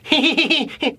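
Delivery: high mocking giggle, imitating two women; a high-pitched giggle like the women laughing at him; imitated giggling. Retelling women laughing at his film, high mocking giggle.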